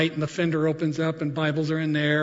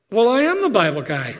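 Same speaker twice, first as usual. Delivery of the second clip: laughing reply; 5 semitones higher than usual. Retold or imitated. Smuggler answers, laughing.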